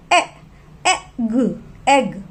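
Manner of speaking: short vowel sound ए, then blend into एग. Shown as a demonstration. Teacher showing children the short e in एग. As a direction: say ए crisp, then एग twice. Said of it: "ई की sound है ए".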